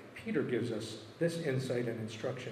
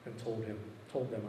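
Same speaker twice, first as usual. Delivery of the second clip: usual speaking voice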